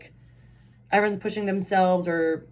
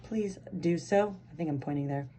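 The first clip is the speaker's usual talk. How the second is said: ordinary delivery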